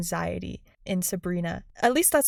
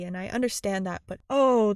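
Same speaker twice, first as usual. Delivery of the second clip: normal talking voice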